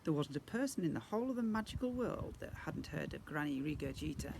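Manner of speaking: usual speaking voice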